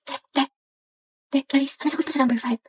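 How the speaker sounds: very soft, secretive classroom murmur; sneaky exam-time plea; furtive hushed asking. Acted. Secretly asking answers during test.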